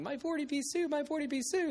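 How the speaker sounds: put-on high wailing child's cry; boy's high mock wail for his coin. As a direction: wail it like a small boy who lost everything. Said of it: "the boy runs off crying".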